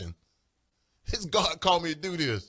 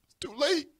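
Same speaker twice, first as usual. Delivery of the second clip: high-pitched verdict with a rising end; high, lilting divine refusal; God's refusal; 10 semitones higher than usual. Retold or imitated. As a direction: pronounce it high, lifting on LATE. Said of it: "God says".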